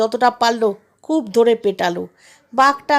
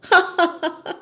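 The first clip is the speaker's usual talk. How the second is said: high-pitched scheming chuckle; villain's gleeful laugh; mocking laugh; 5 semitones higher than usual. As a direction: laugh at the fool once he has gone. Bear laughs at jackal behind his back.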